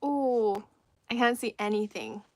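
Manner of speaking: normal talking voice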